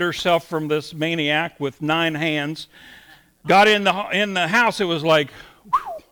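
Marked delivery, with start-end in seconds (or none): 5.7-6.0 high whistled sigh of relief; relieved whew. Retold or imitated. Woman finally home after escaping a bad date, exaggerated relieved whew. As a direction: let out a mock high whew.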